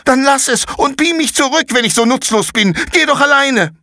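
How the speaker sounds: put-on very high, loud angry boy; furious outburst. Konrad furiously tells Paula to leave. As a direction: shout her away.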